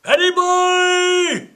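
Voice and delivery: high, loud, slow drawn-out yell; long shout over the troop train; shouted call. Acted. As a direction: hail him from far off. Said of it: "we shouted".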